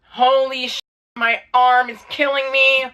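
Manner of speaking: high, mock-agonized cry; drawn-out wail of pain, acted; retold screaming. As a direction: re-enact screaming in pain at moderate volume. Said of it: "I just start screaming in the car".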